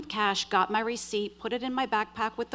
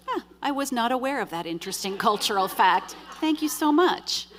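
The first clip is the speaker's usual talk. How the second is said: sarcastic thanks; 3 semitones higher, 4 dB louder than usual. Retold or imitated. Woman answering colleague's cultural fact, sarcastic. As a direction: overdo the gratitude with heavy sarcasm. Sarcastic reply to David's remark.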